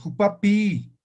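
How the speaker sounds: jupapi with the last i held long; lengthened final vowel. Shown as a demonstration. Teacher demonstrating Aymara vowel length, drawn-out jupapi. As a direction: stretch out the ee ending of jupapi. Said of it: "alargo un poco".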